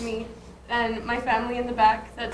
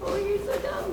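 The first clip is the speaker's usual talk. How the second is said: high laughing tease; 7 semitones higher, 4 dB quieter than usual. Real, not acted. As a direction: toss it off laughing. Laughing jab at crying host.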